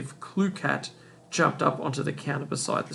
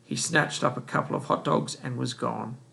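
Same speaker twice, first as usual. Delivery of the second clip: ordinary delivery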